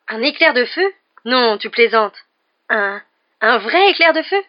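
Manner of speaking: can hardly believe such luck; astonished, pitch leaping up; amazed. A narrator's reading. Dubois amazed at the broom news.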